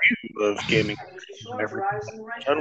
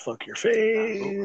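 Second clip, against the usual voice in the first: slow taunt, much higher; mock taunt; 9 semitones higher, 5 dB quieter, much slower than usual. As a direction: draw it out in a raised voice. Taunting teammate, escalating.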